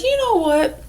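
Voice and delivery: high-pitched quoted voice; lifted, mimicking delivery; imitated man talking. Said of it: "He's like".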